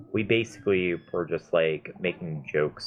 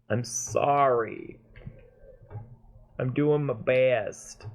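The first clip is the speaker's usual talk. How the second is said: mock apology; 5 semitones higher, much slower than usual. Mock apology to game character.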